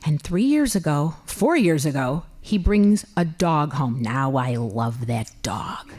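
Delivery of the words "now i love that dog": softer, slow and lower; tender. Real, not acted. Woman admitting she loves the dog, soft and slow.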